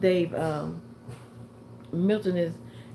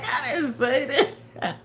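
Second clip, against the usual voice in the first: slow, laughing mock denial for the dog; 6 semitones higher, 3 dB louder, slower than usual. Voicing her dog walking past her, slow and laughing. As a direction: drag out the dog's innocent denial through laughter. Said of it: "looking at me like".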